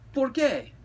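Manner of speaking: high, loud questioning yelp; Skipito. A narrator's reading. Skipito asks the Chihuahuas why, high loud question. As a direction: ask it with pitch way up.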